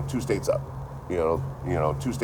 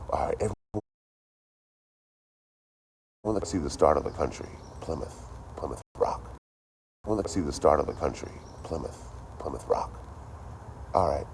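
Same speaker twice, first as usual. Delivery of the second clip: hushed mock guide; 6 dB quieter than usual. Mock tour guide presenting rock.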